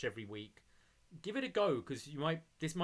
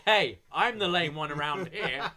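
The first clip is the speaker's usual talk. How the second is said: mock Gargano; 7 dB louder than usual. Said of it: "And Gargano's like".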